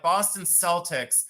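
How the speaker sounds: "Celtics" as SEL-tiks; soft-C pronunciation. Shown as a demonstration. Seller arguing from the team name, "Celtics" with an S. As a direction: start "Celtics" with an S sound. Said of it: "but you say Celtic".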